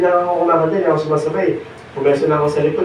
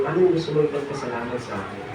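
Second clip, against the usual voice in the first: quick but very soft, lowered pitch; gentle hushed question; 4 semitones lower, 10 dB quieter, faster than usual. Retold or imitated. The Lord asks what to be thankful for, gently hushed. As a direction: fade away as you voice the Lord's question. Repeats the Lord's question to preacher.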